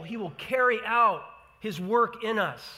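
normal talking voice

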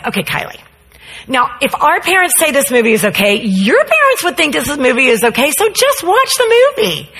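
lightly voiced pushy girl. Girl pressures Kylie.

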